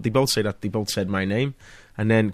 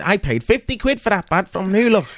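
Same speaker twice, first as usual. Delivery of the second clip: high, lightly voiced wife telling off a star; wife's mock complaint; 9 semitones higher than usual. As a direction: tell him off half-jokingly, like the wife. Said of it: "she said".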